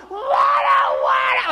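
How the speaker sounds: very high, loud mock toddler wail; toddler tantrum. Dad imitating his son's meltdown when told no, high mock wail. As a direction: shriek it like a two-year-old denied.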